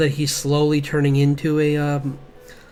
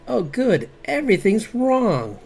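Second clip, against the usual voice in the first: high-pitched sarcastic cheer, slowing to stretch the last words; mock delight; 8 semitones higher, 3 dB louder, slower than usual. Art student seeing a botched printout, sarcastic mock delight. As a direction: feign joy at a disaster, drawing out the ending. Imagined reaction to a ruined print.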